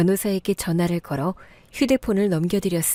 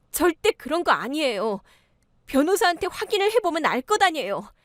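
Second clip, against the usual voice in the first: higher-pitched indignant pleading; wronged protest; 5 semitones higher than usual. Acted. Wronged daughter-in-law protests to her sisters-in-law, indignant. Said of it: "나는 억울함에 몸부림치며 시누이들에게 소리를 질렀습니다".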